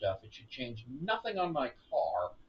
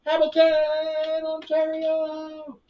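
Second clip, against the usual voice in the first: slow, loud, higher announcer call; mock announcer; 11 semitones higher, 9 dB louder, much slower than usual. Gamer joking about Hamilton by calling out the city like an announcer. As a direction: boom it out like a stadium announcer.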